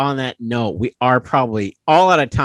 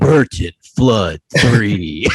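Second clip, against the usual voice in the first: deep, slow trailer announcer; movie trailer voice; 3 semitones lower, 5 dB louder, much slower than usual. Pretend. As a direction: boom it out like an epic trailer. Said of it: "you sounded like the movie, the movie trailer guy".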